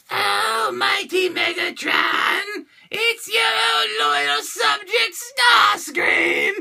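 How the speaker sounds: very high, slow screechy villain voice; terrible Starscream. Acted. Greeting his master Megatron, high screechy bad villain impression. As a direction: screech it slowly like a fawning cartoon henchman. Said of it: "I had a terrible Starscream impression".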